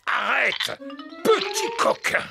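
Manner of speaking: mock scolding. Grandpa scolds snails eating vegetables.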